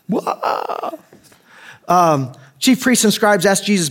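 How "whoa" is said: high, soft, wavering crowd whoa; mock stunned whoa. Onlookers reacting to Jesus's comeback, mock-awed whoa. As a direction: imitate hushed amazement up high.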